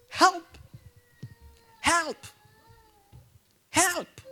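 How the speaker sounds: high desperate shouts of help; retold cries of a drowning boy. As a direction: call out like someone drowning.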